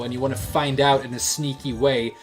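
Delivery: ordinary delivery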